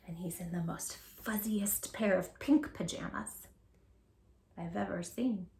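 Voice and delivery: whisper. Shown as a demonstration. Bedtime story read to children.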